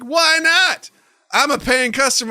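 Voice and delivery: high, indignant mock customer; protesting pimp. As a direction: protest loudly as the pushy guest. Pimp argues back in acted quarrel.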